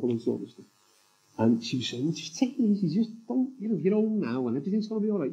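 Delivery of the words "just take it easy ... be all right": higher, gentle imitation of his wife soothing him; lightly voiced wife calming her husband; a hint of the wife's soothing tone; wife reassuring.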